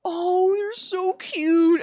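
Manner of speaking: high, drawn-out imitated cooing; mock gushing squeal; gushing tough man. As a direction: melt over a kitten, slow and high. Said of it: "these big tough men".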